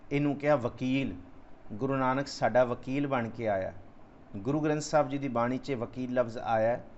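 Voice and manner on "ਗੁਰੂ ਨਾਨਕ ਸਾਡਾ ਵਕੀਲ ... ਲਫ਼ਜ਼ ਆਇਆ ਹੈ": ordinary talk, nothing marked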